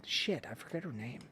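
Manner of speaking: ordinary talk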